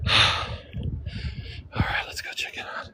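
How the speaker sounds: hushed whisper to the camera. Real, not acted. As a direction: whisper it, barely voiced.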